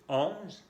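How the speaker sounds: teacher models onze with Z sound; French onze. Shown as a demonstration. French onze with buzzing z. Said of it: "con la Z".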